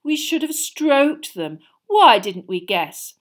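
very slow, high mocking sneer. A narrator's reading. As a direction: drip sarcasm slowly. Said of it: "Malfoy sneered".

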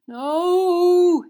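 loud, high drawn-out moan. A narrator's reading. Sirius crouched with hands over head, moaning. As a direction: moan it out loudly in torment. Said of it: "he moaned".

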